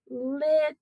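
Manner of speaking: teacher sounding out "lit"; isolated syllable. Shown as a demonstration. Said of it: "Here's our first syllable".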